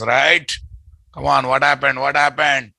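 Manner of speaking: usual speaking voice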